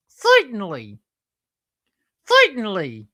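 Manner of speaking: high, loud slapstick catchphrase; Curly from the Stooges. Shown as a demonstration. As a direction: squeal it like a comic stooge. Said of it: "sound like Curly and Moe from".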